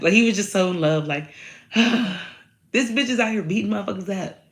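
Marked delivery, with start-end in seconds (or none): 1.4-2.3 dreamy sigh. Retold or imitated. Smitten sigh.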